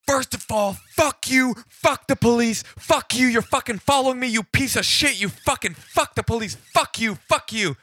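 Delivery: high-pitched furious tirade; really pissed off. Retold or imitated. Interpreter voicing agitated caller to police, furious high rant. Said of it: "He's, like, really pissed off".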